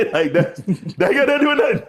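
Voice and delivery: high-pitched, laughing through the words. Real, not acted. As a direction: barely get it out through laughter. Laughing at own story.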